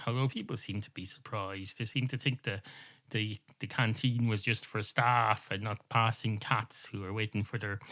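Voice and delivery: normal talking voice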